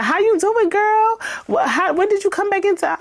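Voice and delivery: very high, sing-song polite greeting; mock sweet greeting. Reader voicing the greeting Isabella skipped, high and sugary. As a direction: chirp it like a fake-nice friend. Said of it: "you didn't even ask her".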